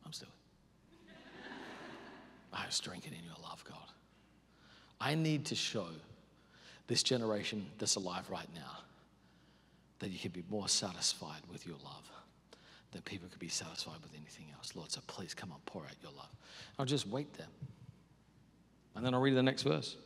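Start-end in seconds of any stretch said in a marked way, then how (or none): 2.5-3.8 hushed, breathy prayer, much softer; whispered prayer. Shown as a demonstration. Showing the congregation her quiet prayer time, whispering to God. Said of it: "demo of quiet devotion". 6.9-16.3 hushed, breathy prayer, much softer; whispered prayer. Shown as a demonstration. Showing the congregation her quiet prayer time, whispering to God. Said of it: "demo of quiet devotion".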